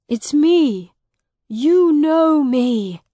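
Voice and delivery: high, loud, drawn-out appeal; desperate pleading. Acted. Miles begs Edith to know him, desperate. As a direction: plead with her to recognise you.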